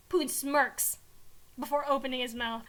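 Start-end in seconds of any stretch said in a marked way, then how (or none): none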